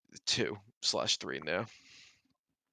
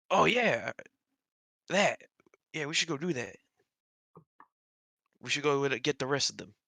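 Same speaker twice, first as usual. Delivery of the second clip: put-on higher, eager young man; Bruce voice; 5 semitones higher, 3 dB louder than usual. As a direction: blurt it out as a keen lad.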